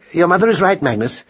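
ordinary delivery, nothing marked

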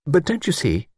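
high, worried pleading; a touch of alarmed spinster; anxious urging. A narrator's reading. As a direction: make him understand the danger. Miss Wainfleet urgent.

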